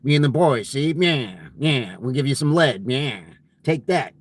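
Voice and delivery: put-on growing into an old-timey tough guy act; nyah-see movie mobster patter; G Edward Robinson. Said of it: "the old-timey accent, the G Edward Robinson".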